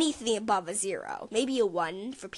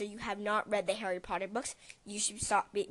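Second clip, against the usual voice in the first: ordinary delivery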